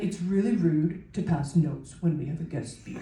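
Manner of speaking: a hint of a prim schoolboy scolding; mock student. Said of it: "and he's like".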